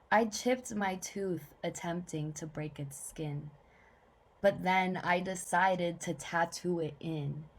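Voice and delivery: restrained spoken-word reading in short phrases; measured poetry reading, falling line ends; poem recital. Acted.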